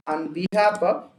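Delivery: ordinary talk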